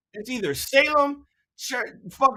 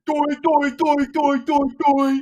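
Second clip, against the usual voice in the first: a touch of a mocking Massachusetts accent, louder; mock Massachusetts talk; 4 semitones higher, 6 dB louder, slower than usual. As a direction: parody the Massachusetts accent with silly doi sounds. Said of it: "expecting me to talk like this".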